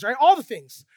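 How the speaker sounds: ordinary delivery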